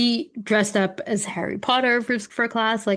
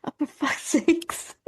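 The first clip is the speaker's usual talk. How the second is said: exasperated dad; 6 semitones higher than usual. Retold or imitated. Dad despairs at his daughter's choice, mock exasperated. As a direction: play the fed-up father, half-joking. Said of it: "Dad was like".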